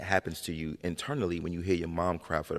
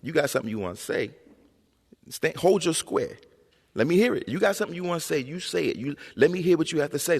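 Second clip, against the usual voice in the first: higher-pitched mother demanding an answer; insistent mother; 9 semitones higher, 3 dB louder than usual. Retold or imitated. Mother to mumbling son, insistent. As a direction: imitate mom pushing her child to speak up. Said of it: "and she'd be like".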